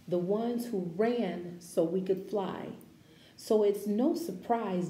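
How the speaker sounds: measured, expressive read-aloud voice; gentle picture-book narration; reading to children. Acted. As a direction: read it like a storybook for a class.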